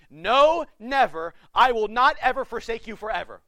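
loud, emphatic refusal to leave; emphatic God. Pretend. God insisting he will never forsake, loud and emphatic. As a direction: hammer every never home. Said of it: "kinda like God saying this".

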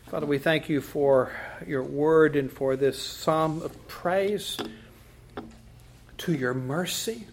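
usual speaking voice

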